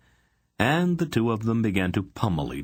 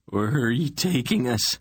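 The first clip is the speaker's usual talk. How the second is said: demanding; 6 semitones higher than usual. A narrator's reading. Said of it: "Besher demanded".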